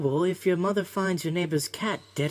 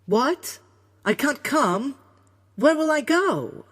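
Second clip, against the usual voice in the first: high, loud cries of disbelief; shocked, distressed outburst; Laughing Jack, shocked; 9 dB louder, slower than usual. A narrator's reading. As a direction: blurt each question out high and aghast. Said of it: "Jack's face turned to shock".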